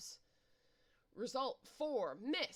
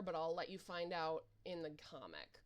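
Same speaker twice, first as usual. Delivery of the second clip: ordinary talk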